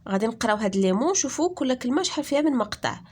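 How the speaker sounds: normal talking voice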